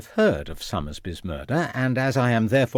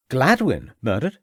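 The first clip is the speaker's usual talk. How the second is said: ordinary delivery, nothing marked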